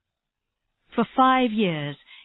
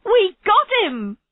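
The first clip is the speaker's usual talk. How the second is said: very high, louder cry of triumph; overjoyed shout, close to tears; laughing through tears; 12 semitones higher, 5 dB louder than usual. A narrator's reading. Tennyson to her team after the case, tearful triumph. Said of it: "she started laughing through her tears".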